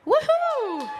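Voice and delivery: very high celebratory woohoo; excited cheer. Real, not acted. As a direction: whoop high for the winner. Cheering a raffle winner.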